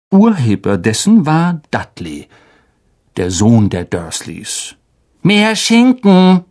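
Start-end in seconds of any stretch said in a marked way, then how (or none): 5.2-6.4 put-on louder, higher, slow demanding boy; greedy son. Spoiled son demanding more ham, loud and slow. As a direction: bellow for more like a spoiled brat.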